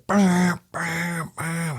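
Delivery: high imitated alarm beeps; alarm beeping imitation. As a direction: mimic the security gate alarm. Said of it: "walk that door and go".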